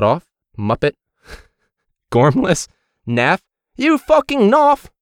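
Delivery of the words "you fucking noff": high, slow British insult; hammed-up British swearing; mock British accent. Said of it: "eleven stellar British swear words".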